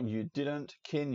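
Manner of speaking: ordinary talk, nothing marked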